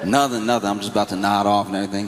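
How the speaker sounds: ordinary talk, nothing marked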